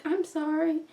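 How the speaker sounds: a lightly voiced timid girl; a small, sheepish apology; meek apology voice. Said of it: "four or five different voices".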